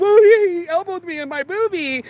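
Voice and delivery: high mock whine of a little sister; whiny sister. Vlogger imitating his sister's complaint, high mock whine.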